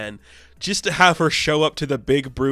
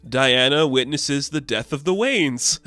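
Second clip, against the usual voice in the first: usual speaking voice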